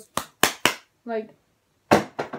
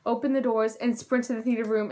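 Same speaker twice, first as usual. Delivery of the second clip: ordinary talk, nothing marked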